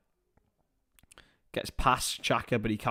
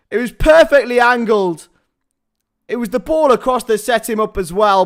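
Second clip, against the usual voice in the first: loud, high-pitched football commentator shout; excited goal commentary; 9 semitones higher, 12 dB louder than usual. Real, not acted. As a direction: call the goal at full force. Live goal commentary at full force.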